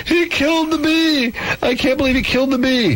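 mock hysterical wailing over a dead bee; hammed-up hysterical girlfriend voice. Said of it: "She became hysterical, exclaiming loudly".

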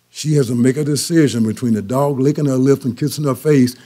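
ordinary talk, nothing marked

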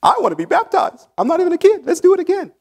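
put-on high excited childlike squeal of a grown-up; eager kid voice. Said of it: "what kid would say no to that".

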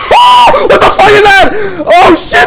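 high loud terrified yelling; panicked screaming. Real, not acted. Monster chases the player.

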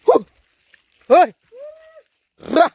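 long loud call into the jungle. Real, not acted. Vlogger calling out through the night jungle, loud call. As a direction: hail loudly to warn off wild animals. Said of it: "आवाज देत आलो".

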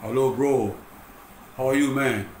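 lightly voiced friend calling to check in; friend's golden voice. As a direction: imitate the friend's smooth voice on the phone. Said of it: "Suara emas dia tu".

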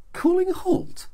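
sharply high, disbelieving repeat; incredulous echo. Acted. Barbie echoing his question back, incredulous. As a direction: throw the words back in disbelief.

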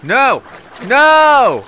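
real loud protest; dismayed shout. Real, not acted. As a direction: wail "no" with rising alarm. Dismay as the game goes wrong.

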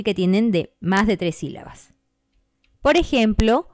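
ordinary delivery

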